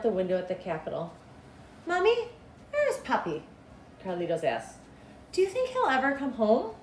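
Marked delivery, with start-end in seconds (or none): 1.9-3.4 put-on little boy's voice. Little boy asking his mother where his father is, high and childlike. 5.3-6.7 put-on little boy's voice. Little boy asking his mother where his father is, high and childlike.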